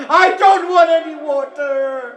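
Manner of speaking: high lamenting cry, first take; weeping plea. Acted. Sakina to her uncle, high weeping plea. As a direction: sob the refusal. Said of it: "And Sakina says".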